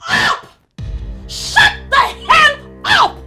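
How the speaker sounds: loud, high outburst with drawn-out words; explosive, high-pitched yelling; furious shout. Acted. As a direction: yell it to silence her. Daughter-in-law snaps at mother-in-law.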